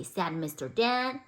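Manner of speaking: usual speaking voice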